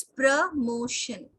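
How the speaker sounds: प्रमोशन drawn out very slowly as "pruh-MO-shun"; very slow प्रमोशन with a weak first vowel; slow pronunciation demo. Shown as a demonstration. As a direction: say प्रमोशन slowly so students hear each sound. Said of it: "प्रो नहीं होगा".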